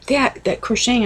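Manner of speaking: daughter's disdain. Retold or imitated. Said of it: "She goes".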